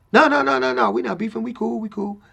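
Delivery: high-pitched, imitated quick denial; hasty reassurance. Friend assuring Pac there's no beef, imitated hasty reassurance. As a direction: wave off the suggestion in a high voice.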